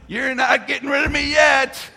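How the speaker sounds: put-on a child shouting down from the bedroom above, defiant yell. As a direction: shout it through the floor like a defiant kid.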